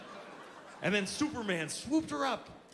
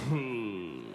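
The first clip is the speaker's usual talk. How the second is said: low "who" slid out without effort; lazy, slack-lipped; 8 semitones lower than usual. Retold or imitated. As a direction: let it leak through loose lips, pitched low. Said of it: "Tighten your lips up".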